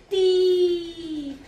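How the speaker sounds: ती held long, high and loud; long-vowel demonstration. Shown as a demonstration. Teacher shows children what it becomes, long loud ती. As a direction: ring out ती with a stretched ee. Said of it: "तो क्या बनेगा?".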